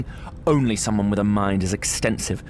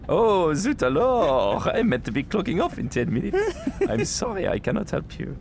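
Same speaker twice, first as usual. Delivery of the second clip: put-on French accent; 5 semitones higher than usual. French controller refusing the pilots, end of shift, French accent. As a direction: shrug them off in a French accent. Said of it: "I got a French degree".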